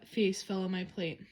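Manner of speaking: plain reading voice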